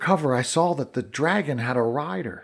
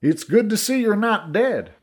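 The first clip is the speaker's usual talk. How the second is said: friendly shout; 8 semitones higher than usual. A narrator's reading. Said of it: "shouted over the sound".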